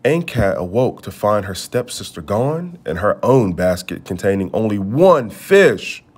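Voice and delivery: ordinary delivery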